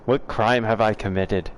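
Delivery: quoting the meme in a hammed-up Australian accent; playful Australian accent impression; mock Australian accent. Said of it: "That's the best Australian accent".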